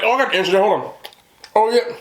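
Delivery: mock know-it-all. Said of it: "They act like they know stuff".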